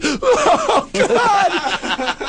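high mock terrified shriek. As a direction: squeal in mock terror. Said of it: "She's like".

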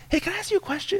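high, soft, careful question to a stranger; imitating a delicate, feeling-out opener; tentative approach.

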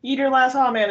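called out loud to everybody; loud catchphrase. Pretend. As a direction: hype up the audience. Catchphrase shouted to audience.

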